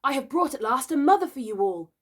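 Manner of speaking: high, loud, proud announcement; glorious tidings. A narrator's reading. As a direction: announce your prize in triumph. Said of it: "to tell the glorious tidings".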